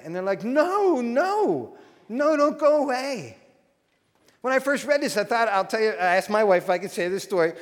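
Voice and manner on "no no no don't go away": raised, drawn-out plea not to leave; mock pleading. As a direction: plead like upset followers, voice lifted. Disciples plead with Jesus not to leave.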